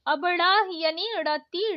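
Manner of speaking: ordinary talk, nothing marked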